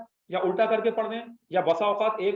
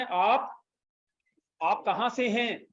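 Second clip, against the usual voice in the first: slow translation; 3 semitones higher, much slower than usual. Shown as a demonstration. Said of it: "आप इस तरह उल्टा करके तर्जुमा करें".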